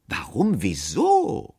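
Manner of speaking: loud, incredulous questions; indignant demand. A narrator's reading. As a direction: demand an answer, affronted. Offended narrator demands reasons.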